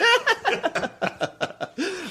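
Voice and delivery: high cackling witch laugh; witch cackle. Real, not acted. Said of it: "I sound like the Wicked Witch".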